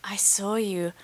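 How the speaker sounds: hushed mock whisper, lurking fan; creepy whisper. Fan at her boyfriend's show recognizing her, mock whisper. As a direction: breathe it like a creep hiding in the crowd. Fan's creepy tweet acted out.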